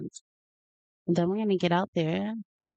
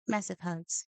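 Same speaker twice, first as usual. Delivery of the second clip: ordinary talk, nothing marked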